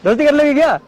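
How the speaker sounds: sudden loud, high question; shouted request. Real, not acted. Man asks woman for friendship, suddenly shouting it. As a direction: blurt the question out at full volume.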